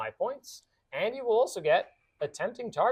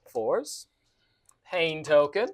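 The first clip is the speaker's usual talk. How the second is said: ordinary delivery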